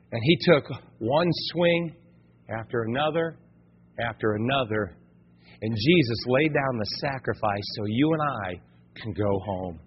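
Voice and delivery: low, soft preaching with weighty pauses; hushed solemnity. Real, not acted. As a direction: let the voice sink gravely. Solemn telling of the sacrifice.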